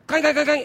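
high, loud mock clanging; engine clang imitation. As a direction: bang it out like pots and pans.

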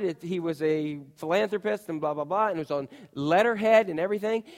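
ordinary talk

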